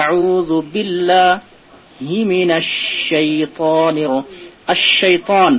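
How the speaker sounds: slow Quranic recitation, each word drawn out. Shown as a demonstration. Teacher recites the verse slowly for students. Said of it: "teacher recites the verse".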